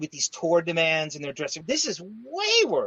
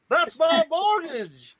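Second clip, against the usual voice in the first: slow, higher Southern drawl, poking fun; host mimics Robin's Southern voice to mock Minnesota; mock Southern accent; 11 semitones higher, 4 dB louder, much slower than usual. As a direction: imitate Robin's Southern put-on, slowly. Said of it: "Robin nailed your accent".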